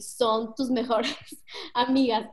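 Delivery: slow "son tus mejores", held back before "amigas"; pauses between words. Shown as a demonstration. Speaker demonstrating speech pauses to students, word by spaced word. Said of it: "haces pausas entre cada palabra".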